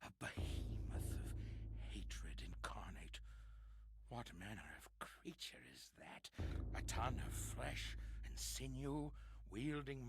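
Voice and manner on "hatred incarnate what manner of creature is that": hushed terrified whisper; fearful whisper. Acted. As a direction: whisper it as if it hears you. Whispered dread of creature.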